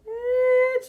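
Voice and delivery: a high, loud snap; sharp retort. Retold or imitated. Snapping back at the host over the photos, loud and high. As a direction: bite the word out.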